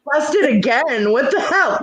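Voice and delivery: high, slow mock outrage; mock surprise. Real, not acted. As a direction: play up being caught.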